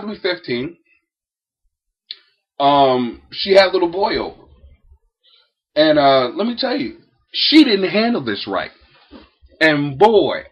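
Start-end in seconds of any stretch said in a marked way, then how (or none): none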